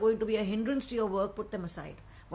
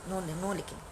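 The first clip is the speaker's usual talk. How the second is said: usual speaking voice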